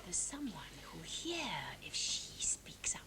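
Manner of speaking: slow, hushed whisper. Acted. Stepdaughter warning that someone may overhear, whispering.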